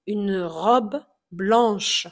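une robe blanche, slow, blanche lingering on the final sh sound; slow feminine form. Shown as a demonstration. Teacher contrasts the feminine form for learners, slow. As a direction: stretch out blanche so the ending is heard. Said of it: "au féminin, devient blanche".

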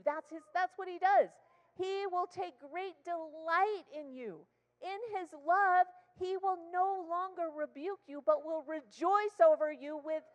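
high, loud, ringing sermon climax; full-force preaching. Real, not acted. Preacher lifting the church to rejoice, high and loud. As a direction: preach it out over the congregation.

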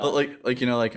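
usual speaking voice

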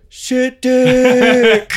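very high jeering call; high mocking heckle. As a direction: shout it from the back. Heckler at rally.

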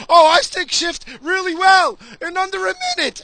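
high, loud, sneering mock voice; mocking a pansy. Mocking young guys who can't drive stick, sneering mock voice. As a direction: ham up a clueless young guy bragging. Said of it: "They think stick shift is".

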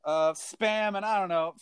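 high, hyped TV host announcing ingredients; mock Guy Fieri host voice; Guy Fieri impression. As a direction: sell the weird ingredients like a game show host. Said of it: "be like".

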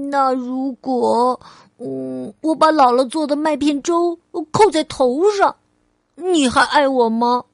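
put-on eager childlike questioning; little girl Ali, testing. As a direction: play a small child posing a what-if. Child character asks mother.

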